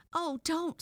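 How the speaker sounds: high, loud yelp of protest; boy protesting pain. A narrator's reading. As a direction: cry out like a boy having his hair pulled. Boy's hair is being pulled.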